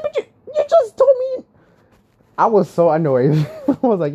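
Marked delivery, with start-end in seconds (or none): 0.0-1.4 very high, squeaky mock outrage; incredulous protest. Customer to the coffee lady who contradicted herself, incredulous. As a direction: protest in disbelief, voice way up.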